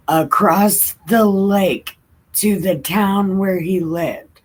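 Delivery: slow, earnest Bible story reading; reading scripture aloud. Acted. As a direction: read it like a children's Bible story.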